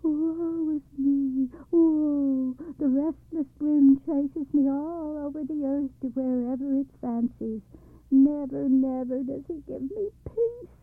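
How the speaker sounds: high, loud wailing lament; wailing cry. Acted. Cloud wailing to father and girl about the wind. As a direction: wail it out like a hounded cloud. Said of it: "he barely managed to cry out".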